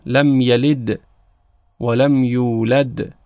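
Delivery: یلد and یولد each ending in a bouncing د, slow; qalqala on dal. Shown as a demonstration. Teacher showing two bouncing dals in لم یلد و لم یولد. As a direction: echo both final dals. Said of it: "دو تا دال داریم".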